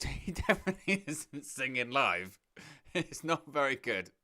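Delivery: talking through slow laughter; laughing. Real, not acted. Commentator amused by a singer caught miming, laughing. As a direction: crack up while trying to get the words out.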